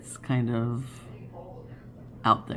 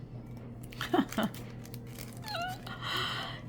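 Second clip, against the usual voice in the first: high giggle; 10 dB quieter than usual. Real, not acted.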